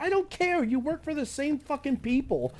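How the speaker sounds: high, heated retort; angry retort. Retold or imitated. Answering the new caseworker, high angry retort. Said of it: "I'm like".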